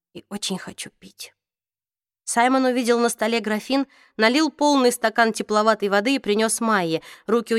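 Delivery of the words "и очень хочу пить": faint, parched whisper. A narrator's reading. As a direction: breathe it out, exhausted. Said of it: "прошептала она". (слабый шёпот)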